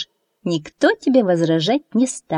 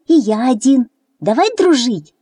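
higher, louder pleading goat; insisting; 6 semitones higher, 4 dB louder than usual. A narrator's reading. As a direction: press the offer of friendship. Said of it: "настаивал козлёнок".

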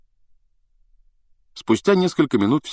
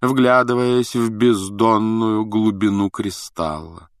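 slow, mystical singsong on a raised pitch; 6 semitones higher, slower than usual. A narrator's reading. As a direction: intone it slowly, stretching the vowels. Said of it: "говорила она нараспев". (нараспев, протяжно)

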